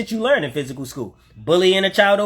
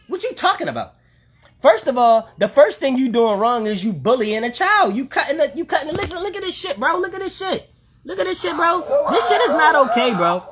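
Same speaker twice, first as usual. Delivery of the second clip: outraged disbelief; 7 semitones higher than usual. Real, not acted. Ranting in outrage at the video.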